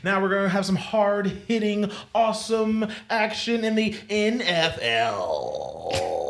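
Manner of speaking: high, loud hyped nineties announcer; fighting game announcer. Pretend. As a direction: pump it up like a nineties sportscaster. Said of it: "Like the nineties voice that comes on".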